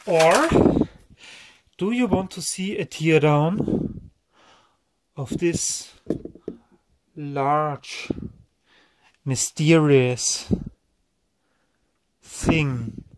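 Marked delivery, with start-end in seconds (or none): 5.2-13.0 slow, low, drawn-out mock suspense; low, portentous tease; hammed-up mysterious. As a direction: build mystery with long pauses and a lowered pitch. Hammed-up mystery of unknown item.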